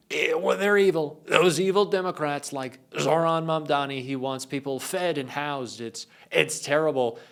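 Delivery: a parody Trump scoffing at evil Democrats. Retold or imitated. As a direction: sneer it like Trump dismissing Democrats. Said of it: "I keep doing the Trump voice".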